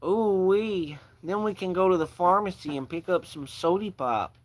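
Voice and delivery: high-pitched, hammed-up Midwest accent. Shown as a demonstration. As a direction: put on a folksy Midwest accent. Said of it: "I can do a Midwest accent".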